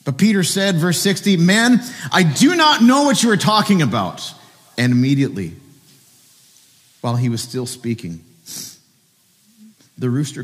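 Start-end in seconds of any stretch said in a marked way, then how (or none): 1.4-4.3 loud, high-pitched protest; vehement denial. Acted. Peter's last denial to his accuser, vehement loud protest. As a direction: blurt it out loudly, voice pitched way up. Peter loudly denies knowing Jesus.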